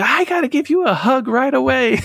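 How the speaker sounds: very high-pitched imitation of an eager sister; excited gushing. New sister running up at first meeting, excited gushing. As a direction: gush it high, laughing at the end.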